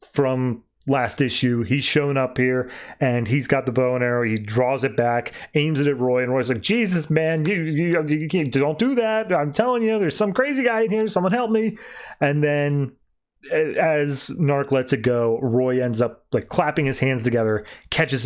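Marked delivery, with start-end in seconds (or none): 6.6-11.8 high-pitched, stammering plea for help; panicked plea. Retold or imitated. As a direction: imitate a flustered man begging not to be shot. Held at arrowpoint, pleading for help.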